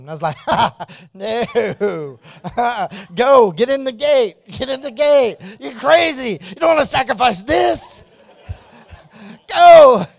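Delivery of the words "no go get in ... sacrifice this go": high, loud protest broken by laughs; frantic laughing no, much louder and higher; laughing panicked refusal. Retold or imitated. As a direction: yell it through your own laughter, half in fun.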